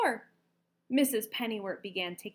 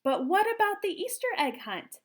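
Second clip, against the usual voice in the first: high, louder worried question; higher, louder anxious question; worried egg lady; 10 semitones higher, 6 dB louder than usual. A narrator's reading. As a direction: ask anxiously. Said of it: "the egg lady said".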